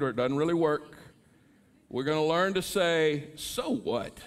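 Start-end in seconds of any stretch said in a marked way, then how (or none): 3.4-4.2 with attitude. Shown as a demonstration. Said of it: "say with a little attitude".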